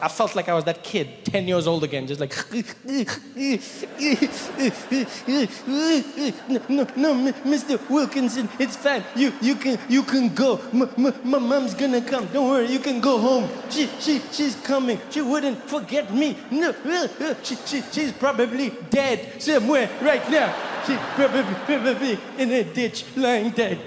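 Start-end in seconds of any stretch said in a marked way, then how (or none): 2.4-5.6 high hitching sobs; hiccupping child's sobs, hammed up; mock sobbing. Forgotten kid waiting at school, mock sobbing. 5.7-23.8 high, stammering, hammed-up crying kid; mock tearful child. Forgotten kid to the waiting teacher, mock tearful stammer. Acts himself as forgotten ten-year-old.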